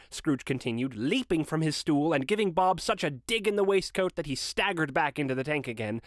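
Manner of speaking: plain reading voice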